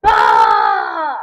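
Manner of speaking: loud yell breaking into screaming; frustrated scream. Real, not acted. Gamer missing a jump, frustrated scream. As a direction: howl at the missed jump.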